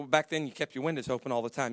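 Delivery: usual speaking voice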